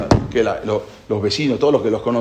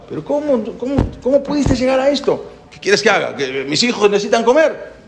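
shocked disbelief; 9 semitones higher than usual. Acted. Friend shocked at beggar at door.

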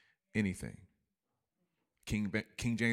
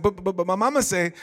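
higher, louder stammering protest; Waterboy protesting; 9 semitones higher, 8 dB louder than usual. Retold or imitated. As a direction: sputter it out, flustered. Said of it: "the water boy said".

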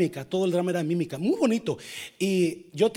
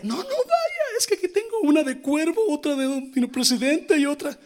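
put-on drunk friend; 11 semitones higher, 4 dB louder than usual. Drunk friend voiced in story.

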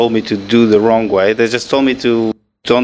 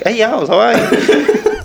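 much higher, slow, laughing mock greeting; mocking caller reply; 9 semitones higher, slower than usual. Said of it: "They were like".

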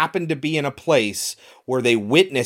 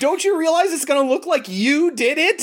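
high, exasperated question; incredulous scolding; 12 semitones higher than usual. Retold or imitated. Friend scolds the movie woman, high and exasperated. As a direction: talk sense into the fool.

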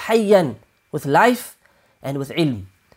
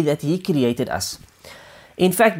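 normal talking voice

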